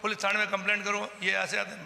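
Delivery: retold heated demands, near a shout; angry shouting. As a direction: bark the orders as the enraged man did. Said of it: "उन्होंने कहा".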